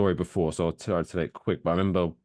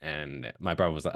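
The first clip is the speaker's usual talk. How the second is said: normal talking voice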